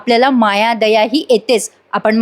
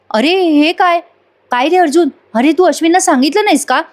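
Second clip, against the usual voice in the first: higher, hurt questioning voice; Priya confused; 6 semitones higher than usual. Acted. Priya asking Arjun, higher and bewildered. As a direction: ask in dismay why he was not told. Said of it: "Priya म्हणत असते".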